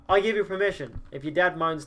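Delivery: normal talking voice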